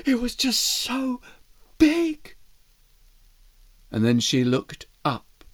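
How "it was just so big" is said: high, breathless wonder; awed mouse voice. Acted. Mouse gazing at the huge garden, high and awed. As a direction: marvel at everything, wide-eyed.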